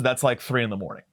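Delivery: ordinary delivery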